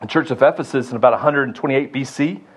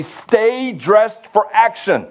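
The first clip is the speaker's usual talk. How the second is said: urgent command; 8 semitones higher, slower than usual. Acted. Urgent call against complacency.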